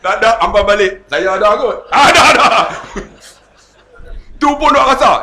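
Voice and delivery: loud teasing banter. Real, not acted. Preacher joking about the kenduri, loud and laughing. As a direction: tease the crowd loudly, half laughing.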